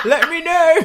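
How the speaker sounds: loud laughing tease. Real, not acted. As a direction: cackle the cheeky question out. Joking loudly about new breasts.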